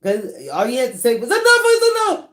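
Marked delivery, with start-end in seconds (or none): 1.3-2.3 high, fast, loud outburst; mock-preaching holler of protest; exasperated shout. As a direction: shout it out, pitched way up. Acts out what he should've said.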